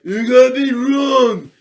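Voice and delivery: Rocky addressing the crowd; mock Rocky speech. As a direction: drag it out like a punch-drunk boxer. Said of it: "He's like".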